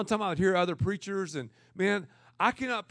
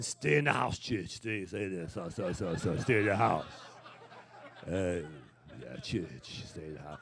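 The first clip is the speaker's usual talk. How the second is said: much lower, softer preacher impression; impression of Brian Houston; 13 semitones lower, 4 dB quieter than usual. Pastor imitating Brian Houston for his audience, low mock. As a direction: drop the voice deep and murmur the line. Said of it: "My man Brian Houston".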